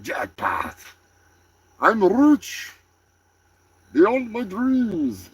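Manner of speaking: weak imitated Arabic accent; half-hearted Arabic accent, slow at the end.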